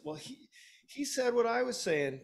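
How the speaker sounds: high-pitched whiny imitation of a kid; squabbling child. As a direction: mimic a tattling little kid, voice jumping up high. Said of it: "my six-year-old and eight-year-old".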